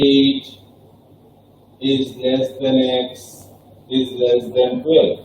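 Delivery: slow model reading for the class; slow demo reading. Shown as a demonstration. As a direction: read the chain word by word. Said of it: "যেমন আমাদের এইভাবে আসে".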